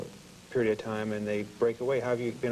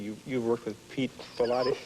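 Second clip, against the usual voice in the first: ordinary delivery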